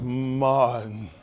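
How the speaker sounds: low, drawn-out way of saying the name; imitating Coretta. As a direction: throw the name out, low and slow. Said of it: "It's the way she throw Martin's name".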